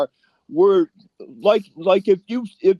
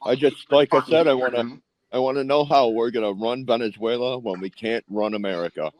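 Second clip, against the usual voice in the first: muffled; 7 semitones lower, 5 dB quieter than usual. Real, not acted. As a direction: voice muffled, like through a wall. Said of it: "You sound muffled".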